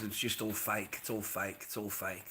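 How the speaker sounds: very soft, low retelling; low, soft, weary-sounding dismissal; quiet reassurance. Retold or imitated. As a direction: play it down, hushed and subdued. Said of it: "I've told them a few times".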